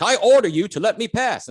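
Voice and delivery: high-pitched, commanding Monty Python king; imperious king. Acted. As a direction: issue a royal order in a high put-on voice. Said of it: "a king is trying to pass".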